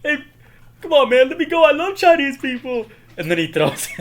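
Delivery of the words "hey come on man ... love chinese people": high, loud comic begging; mock panicked pleading. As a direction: plead frantically in a hammed-up high voice. Acted panic while being beaten.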